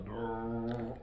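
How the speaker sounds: a low rumbling brrr like a plane overhead; low airplane buzz. Shown as a demonstration. Telling his listeners what the airplane sounds like, low engine buzz. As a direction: hum low and buzzy like an engine.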